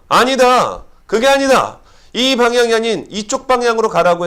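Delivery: loud, high firm command; commanding divine voice. Acted. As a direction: proclaim it as God's order. God's command voiced in sermon.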